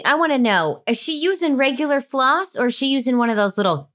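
ordinary talk

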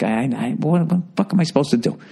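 stammering, rising mock protest; flustered helplessness. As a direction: sputter helplessly, then rush the question. Helpless pushover dad answering his wife.

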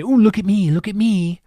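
showing-off voice; boastful mock voice. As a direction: preen like a show-off. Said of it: "I'm not saying that to say".

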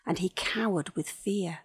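usual speaking voice

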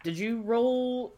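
ordinary talk, nothing marked

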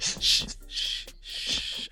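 breathy whispered shh, soft; mock shushing. Coach hushing Goff like a child, whispered shh. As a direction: hush him like a fussy child.

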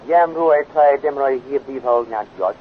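slow, measured recital of a foreign saying; old Venusian saying. Acted. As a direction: Jan solemnly recites an old Venusian blessing to friends, slow and formal. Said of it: "we have an old saying here".

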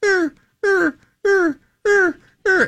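very high, repeated short squeals; imitated dog yelps. Telling hosts what he heard through the wall, imitated yelps. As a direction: mimic a small animal crying out. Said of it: "I just heard, like".

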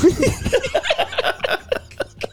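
long, high breathy laugh. Real, not acted. Host amused by their own yellow brick road line, laughing. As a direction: crack up in a drawn-out laugh.

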